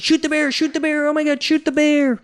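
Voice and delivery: a panicked camper urging his friend to shoot; panicked buddy. Retold or imitated. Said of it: "his buddy that's holding the camera says".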